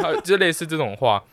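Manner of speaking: ordinary delivery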